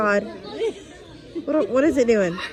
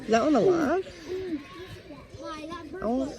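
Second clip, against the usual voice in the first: ordinary talk, nothing marked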